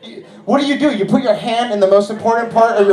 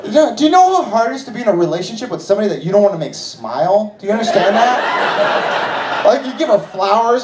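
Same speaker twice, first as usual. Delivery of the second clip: ordinary delivery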